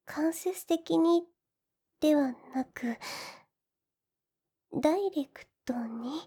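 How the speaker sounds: whispered, embarrassed; shy whisper. Acted. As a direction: murmur it, too shy to say aloud. Shy heroine asks for a kiss. (照れたささやき)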